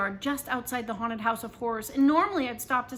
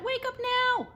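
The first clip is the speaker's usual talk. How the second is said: high ringing wake-up call, much higher; farmhouse shout; 12 semitones higher than usual. A narrator's reading. Narrator mimicking the rooster's morning call, shout. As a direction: belt it like a rooster waking the farm. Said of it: "farmhouse shout".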